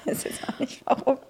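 unvoiced whisper aside. Real, not acted. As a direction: whisper it to yourself. Self-deprecating aside.

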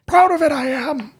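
put-on high, hammy old sailor growl; gruff old fisherman. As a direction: play a proud salty sea dog. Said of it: "turning a bit into a sailor".

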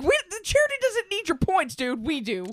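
high, loud mock reply; mock the charity. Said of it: "I'm like".

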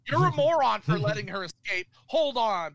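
mock-furious villain berating a lackey; scolding villain. Gnome King berating Mombi, a loud mocking scold.

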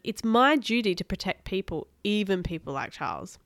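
usual speaking voice

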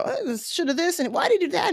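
high, soft, whiny mock voice; mocking armchair critic. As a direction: mimic a couch critic. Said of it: "sit at home and go".

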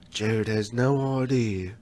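slow, lightly voiced nature-show narrator; a hint of a wildlife narrator, slow; documentary narration. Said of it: "like, narration?".

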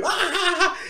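high laughing ah ah; cackling at the trick; laughing ah sounds; mock cackle. Imitating their laughter.

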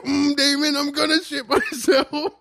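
very high, loud, strained squeal; mock panic, slowly squeezed out while holding it in; imitated desperate straining. Acts out dad desperately holding it in.

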